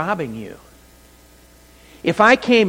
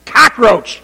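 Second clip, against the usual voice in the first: much higher, much louder mock scream; imitating a panicked scream; 11 semitones higher, 11 dB louder, slower than usual. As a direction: shriek it like she's up on a chair.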